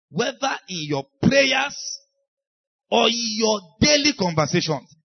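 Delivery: slow, loud, high preaching shout; shouted emphasis. Real, not acted. Preacher shouting to his congregation, slow and loud. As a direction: drive the point home with a slow shout.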